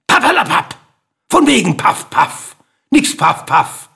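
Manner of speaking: high, loud, sharp scoffing bird; hoarse hissing bird. A narrator's reading. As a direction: spit it out as a disagreeable little bird. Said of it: "zischte nun mit einer fast schon unsympathischen, heiseren Stimme". (heiser zischender, unsympathischer Vogel)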